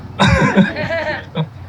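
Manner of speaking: laugh. Real, not acted. Laughing at grandpa's bluff.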